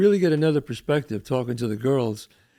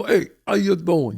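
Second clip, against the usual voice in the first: slow, lazy "Hey, how you doing?" in a mock accent; 2 semitones higher than usual. Retelling her comeback, drawling the greeting in a mock accent. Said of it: "Did I look at you and go".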